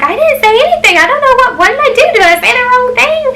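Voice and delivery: very high-pitched mock protest; flustered grandma. Grandma defends herself to mom on the phone, flustered.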